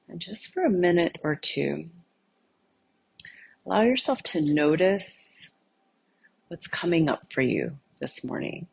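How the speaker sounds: soft-spoken invitation to notice and welcome; guided meditation. Acted. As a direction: gently lead them inward, leaving space between phrases. Leads an eyes-closed meditation.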